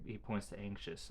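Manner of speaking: ordinary talk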